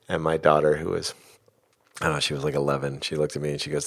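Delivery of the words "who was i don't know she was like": usual speaking voice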